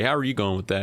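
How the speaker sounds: ordinary talk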